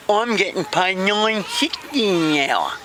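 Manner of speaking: high, slow, mangled words; garbled character voice. Acted. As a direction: stretch it out in a high put-on voice.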